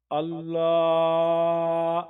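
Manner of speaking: slow, loud, stretched syllables; drawn-out vowels. Shown as a demonstration. Teacher stretches the vowels for students to follow. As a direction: draw each vowel out. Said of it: "teacher stretching vowels".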